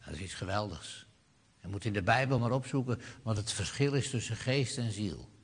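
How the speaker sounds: ordinary delivery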